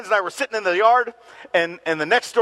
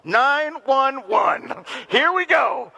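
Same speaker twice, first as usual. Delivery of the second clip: mock kid dialing; 7 semitones higher, much slower than usual.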